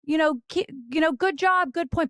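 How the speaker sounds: high, bright sideline encouragement; high-pitched parent cheering from the stands; cheering on a child. Retold or imitated. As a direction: cheer her on like a tennis parent. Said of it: "you're allowed to go like".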